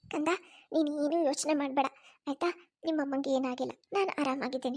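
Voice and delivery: put-on soft, low soothing to her child; quiet, gentle mother's comfort; tender reassurance. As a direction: hide her hurt to calm the boy.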